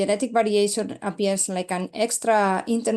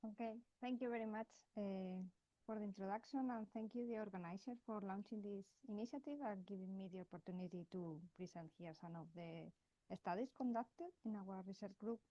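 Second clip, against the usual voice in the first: faint and far from the mic; barely audible; 23 dB quieter, faster than usual. Real, not acted. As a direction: speak too far from the microphone. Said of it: "your voice is very quiet".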